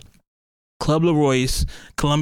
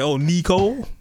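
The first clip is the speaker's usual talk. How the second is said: imitating daughter; 5 semitones higher than usual. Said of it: "my daughter does it".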